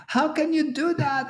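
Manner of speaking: high mock whine of the client; high, whiny imitation of the angry client; mocking the upset client. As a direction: parody his outrage in a squeaky voice. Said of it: "the guy got upset".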